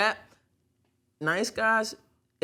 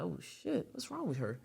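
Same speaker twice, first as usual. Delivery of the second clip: shocked mutter; 12 dB quieter than usual. Retold or imitated. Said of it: "Then I'm like".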